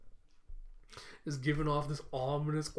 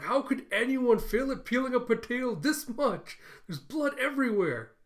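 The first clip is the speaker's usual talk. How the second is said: high, loud mock outrage; exasperated scolding; 8 semitones higher, 5 dB louder than usual. Camilla scolds Yor over the bloody potato, mock outraged. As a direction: act out an incredulous telling-off.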